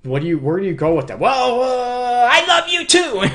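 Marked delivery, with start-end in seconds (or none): 1.2-3.2 high, mock-sheepish reply, pitched way up; a hammed-up partner suddenly melting after the kind words. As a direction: play the disarmed partner caving in. Partner answers the loving reply.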